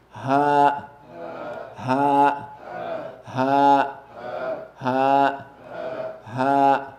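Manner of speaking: ha pushed from the bottom of the throat, close to the chest; ha, a strong breathy sound from deep in the throat. Shown as a demonstration. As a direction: let ha vibrate the chest as you say it. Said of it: "Make this one deep".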